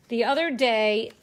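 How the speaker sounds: normal talking voice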